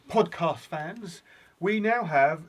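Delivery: slow, emphatic show announcer; mock announcer. Host introducing hints to podcast fans, announcer style. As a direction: announce the segment grandly, drawing it out.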